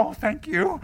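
hammed-up thank you; gushing fan. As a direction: overdo the grateful fan. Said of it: "Some of them go".

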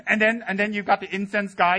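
ordinary delivery

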